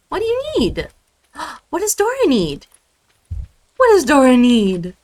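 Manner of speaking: very high cooing pet voice. Real, not acted. Baby talk to her cat.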